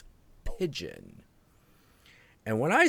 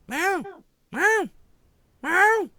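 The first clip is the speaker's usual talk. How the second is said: high mewing cat imitation; cat meowing; slower than usual. Cat owner imitating his cat's demanding meows. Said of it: "and he's like".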